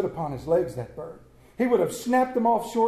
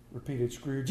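ordinary delivery, nothing marked

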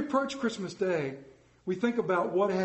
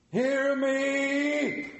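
crying out; 7 semitones higher, 3 dB louder than usual. A narrator's reading. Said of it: "cried the ghost".

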